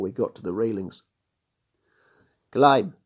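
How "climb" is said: loud shouted command; shouted order. Acted. Inspector forces Johann over the railing, shouting. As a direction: yell it to force him over. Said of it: "shouted Mapimpi".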